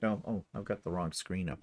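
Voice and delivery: usual speaking voice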